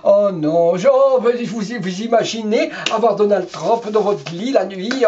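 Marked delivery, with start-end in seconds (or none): none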